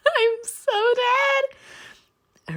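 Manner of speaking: very high, long drawn-out wail; swooning squeal. Real, not acted. Reader overcome by Lucifer's flirting, high fangirl wail. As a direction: wail it slowly, overcome with delight.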